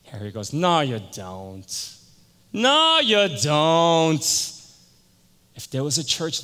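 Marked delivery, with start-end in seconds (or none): 0.5-2.0 low, slow, drawn-out disbelief; sceptical denial. Retold or imitated. Harry doubts Tony's church, slow low denial. 2.5-4.5 very slow, loud, high drawn-out disbelief; emphatic denial. Retold or imitated. Harry flatly refuses to believe Tony, loud drawn-out denial.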